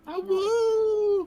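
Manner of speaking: long, high, loud wolf howl. Acted. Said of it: "like a wolf awoo".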